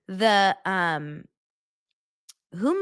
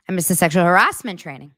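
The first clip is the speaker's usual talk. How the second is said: loud mimicry, leaning hard on "harassment"; imitated rising cadence; 6 dB louder than usual. Replaying his line for viewers, voice leaping up on "harassment". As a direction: lift "harassment" sharply up, then let it fall. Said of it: "His voice goes up".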